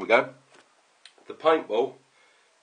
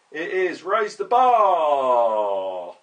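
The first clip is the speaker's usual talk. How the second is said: loud, higher, last word stretched long; mock announcer; 5 semitones higher, 9 dB louder, much slower than usual. Revealing who the order is for, mock announcer. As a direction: draw out the name like a big reveal.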